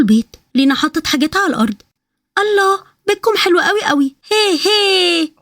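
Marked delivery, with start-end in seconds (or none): 2.4-5.3 put-on high, slow delighted child with a squeal; a small child marvelling at the house, pitched way up; excited little girl. Little girl delighted by the men's house, high squealing child voice.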